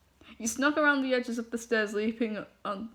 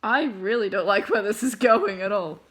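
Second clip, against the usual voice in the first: dread; 7 dB louder than usual. Real, not acted. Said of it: "dreads the coming story".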